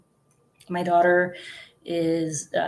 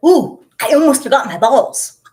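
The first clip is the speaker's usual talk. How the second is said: loud, higher imitation of husband; playfully imitated shout; mock calling out; 6 semitones higher, 11 dB louder than usual. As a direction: holler it like a goofy announcement. Husband calling out, acted quote.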